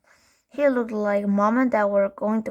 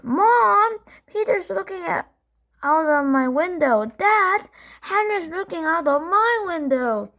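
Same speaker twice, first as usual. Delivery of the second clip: screaming; 7 semitones higher than usual. A narrator's reading. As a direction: yell for both parents. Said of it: "Then he screamed".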